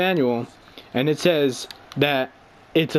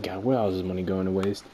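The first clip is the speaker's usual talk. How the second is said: much lower and softer, sighing to himself; muttered inner grumble; 10 semitones lower, 7 dB quieter than usual. Retold or imitated. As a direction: grumble it quietly as a private thought. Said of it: "and I'm just like".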